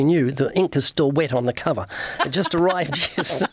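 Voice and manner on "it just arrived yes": amused, breaking into a laugh. Real, not acted. Author telling host the book is brand new, laughing. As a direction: say it through a laugh, cutting off.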